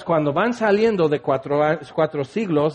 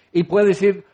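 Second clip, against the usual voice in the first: normal talking voice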